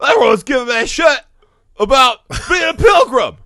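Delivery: high, loud, hammed-up complaint; mock Freddie Prinze Junior. Imitating the actor complaining in Paladin's room, mock shouting. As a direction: whine it out loud and high, mocking. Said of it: "goes into Paladin's room and he says".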